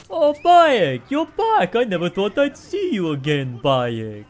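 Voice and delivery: high, loud gushing merchant parody; over-the-top merchant. Retold or imitated. Mocking a game merchant who overdoes greeting Bayek, loud and high. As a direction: ham it up like an overexcited shopkeeper. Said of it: "over-exaggerate everything they say".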